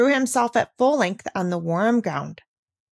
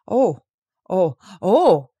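each oh more disapproving and louder; growing disapproval; 4 dB louder, slower than usual. A narrator's reading. Peter objecting to Heidi's climbing plan, rising disapproval. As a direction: protest harder with every oh. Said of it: "exclaimed Peter, his disapproval of Heidi's suggestion becoming more marked with each ejaculation".

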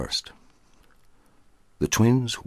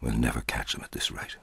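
soft, breathless whisper to himself; whispered curse; 4 semitones lower, 6 dB quieter than usual. A narrator's reading. Skipper cursing to himself after losing the twins, whispering. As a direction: mutter it under your breath, frustrated. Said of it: "He cursed and whispered to himself".

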